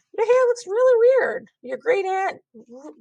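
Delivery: put-on high, loud bratty kid; snotty kid voice. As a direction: blurt it out like a rude child. Mean thought bubble voiced.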